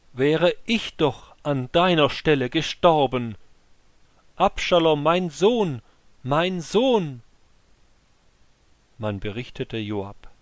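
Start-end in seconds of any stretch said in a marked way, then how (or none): 0.1-7.2 higher, louder wail of grief; grieving cry. Acted. King mourning his dead son aloud, anguished cry. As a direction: wish you had died instead, crying out. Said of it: "rief er im Gehen".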